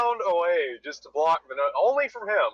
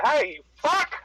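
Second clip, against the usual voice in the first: road rage; 9 semitones higher, slower than usual. Real, not acted. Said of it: "you're gonna hear my road rage".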